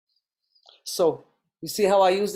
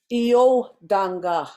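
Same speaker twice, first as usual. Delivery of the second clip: "iyo danga" slow, the O lifted higher and stronger; raised accent on O; 5 dB louder, much slower than usual. Shown as a demonstration. Teacher demonstrating Nakota accent, iyo danga with raised O. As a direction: lift the O in "iyo danga", then let it fall. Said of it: "I said the O slightly higher".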